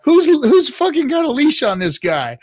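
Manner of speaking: 1 retold disbelief. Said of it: "I was like".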